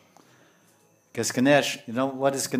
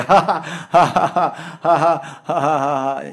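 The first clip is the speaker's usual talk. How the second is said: loud, high forced laughter; hammed-up "ha ha ha"; demonstrated laughing; 4 semitones higher, 10 dB louder, slower than usual. Shown as a demonstration. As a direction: keep a hearty laugh going on purpose. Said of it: "That's five seconds. That's enough".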